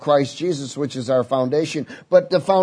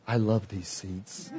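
blissful; 7 semitones lower, 12 dB quieter, slower than usual. Retold or imitated. Relishing the heated seats.

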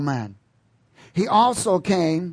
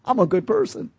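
mock good person; 6 semitones higher than usual. Mocking a self-righteous churchgoer.

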